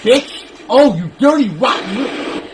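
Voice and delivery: loud, high angry outburst at the screen; furious yell. Real, not acted. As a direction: blow up in real rage at the enemy. Gamer raging at an enemy.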